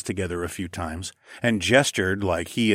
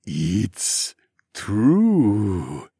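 slow, long swoop on each word; droning slothman. A narrator's reading. Slothman conceding after a laugh, slow drone. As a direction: draw both words out like a sloth. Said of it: "Ozzy droned".

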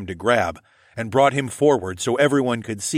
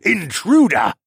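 high, loud accusing yell; 9 semitones higher, 7 dB louder, slower than usual. A narrator's reading. Wounded lord accusing the stowaway, yelling. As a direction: yell it as an alarm. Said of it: "Siscorn yelled".

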